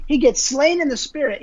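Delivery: ordinary delivery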